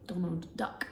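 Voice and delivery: usual speaking voice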